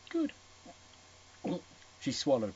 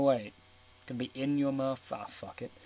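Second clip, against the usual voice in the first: ordinary delivery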